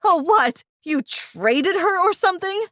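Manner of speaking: high, sharp indignant retort; indignant cry. Acted. Daughter retorting to Mom's excuse, indignant and high. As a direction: throw it back at Mom, disgusted. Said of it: "I cried".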